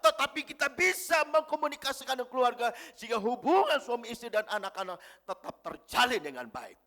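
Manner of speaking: loud, high preaching voice, driving each phrase; full-force preaching. Acted. Preacher exhorting the congregation about parents' love, booming. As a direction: proclaim it to the whole hall.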